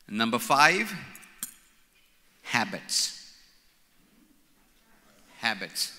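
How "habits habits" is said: habits said the Indian way. Real, not acted. Preacher saying habits his Indian way. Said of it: "I'm saying it my Indian way".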